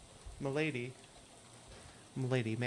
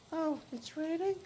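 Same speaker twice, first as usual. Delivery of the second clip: put-on much higher, thin ghostly girl voice; hitchhiker Lydia; 14 semitones higher than usual. Hitchhiker asking the player for a ride, high ghostly voice. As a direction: play the strange girl in the rain begging a lift.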